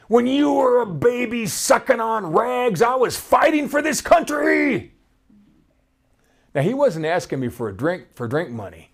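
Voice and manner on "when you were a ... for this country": higher, half-voiced angry yell; a hint of the old man's yell; mild retold yell. Homeless man yelling at the speaker, mild mock yell. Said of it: "He screamed so loud".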